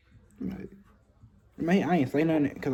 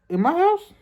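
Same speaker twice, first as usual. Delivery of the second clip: way higher, shocked squeal; outraged mom; 5 dB louder than usual. Retold or imitated. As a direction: mom can't believe it. Mom's outraged question.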